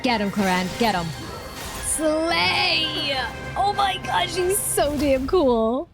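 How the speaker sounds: ordinary delivery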